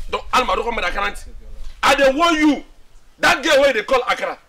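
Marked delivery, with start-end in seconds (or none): 1.8-4.4 raised, higher voice; heated, louder threat; angry warning. Acted. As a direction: warn them off forcefully. Warning friends off insulting girl.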